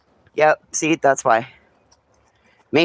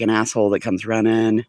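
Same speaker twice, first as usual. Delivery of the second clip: ordinary talk, nothing marked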